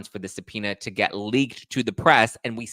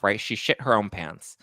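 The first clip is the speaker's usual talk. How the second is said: usual speaking voice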